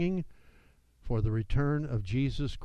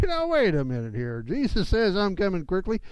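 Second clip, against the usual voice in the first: mock skeptical critic; 6 semitones higher, 3 dB louder than usual. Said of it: "a lot of critics who will say".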